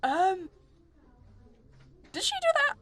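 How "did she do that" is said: squeaky astonished question; shocked high question. Acted. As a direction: voice jumps up in disbelief. Shocked at drawings.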